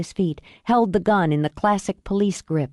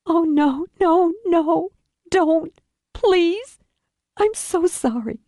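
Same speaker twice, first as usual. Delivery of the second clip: high, anguished daughter's voice; distraught pleading; 10 semitones higher, slower than usual. Acted. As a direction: plead over your dying father. Daughter beside her dying father.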